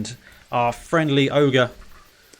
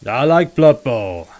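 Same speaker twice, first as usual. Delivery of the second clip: ogre voice; 6 dB louder, slower than usual. Acted. Ogre bonds over Blood Bowl.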